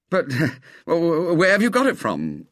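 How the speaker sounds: high, halting, open-mouthed stammer; stammered in amazement. A narrator's reading. Robert stammers at William's gift, amazed and halting. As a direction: caught off guard, stumbling over words. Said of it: "stammered Robert".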